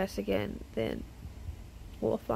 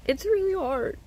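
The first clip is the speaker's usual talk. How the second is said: close to tears; 4 semitones higher than usual. Real, not acted. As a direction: let it crack, then fade away. Close to crying, ends the confession.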